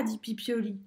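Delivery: plain reading voice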